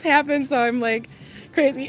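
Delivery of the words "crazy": normal talking voice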